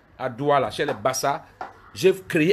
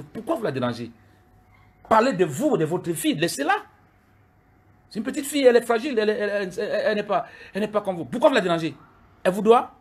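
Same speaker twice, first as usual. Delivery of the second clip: indignant defense; 6 semitones higher than usual. Real, not acted. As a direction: raise the voice defending her. Defending girlfriend, voice rising high. (défense indignée, voix haussée)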